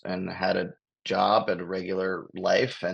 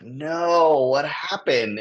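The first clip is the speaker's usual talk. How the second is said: higher, louder disbelief; shocked no; 7 semitones higher, 5 dB louder, much slower than usual. Retold or imitated. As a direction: react with real shock. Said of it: "I'm like".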